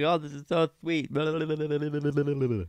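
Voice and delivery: mocking, put-on gushing voice; sneering imitation running into babble. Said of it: "And he's like".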